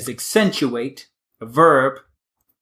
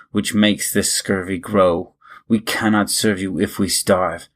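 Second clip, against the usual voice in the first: measured, rhythmic verse recital; poem reading; 5 semitones lower than usual. Acted. Host reading a Kipling poem to listeners, rhythmic recital. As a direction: read it like ballad verse, steady and even.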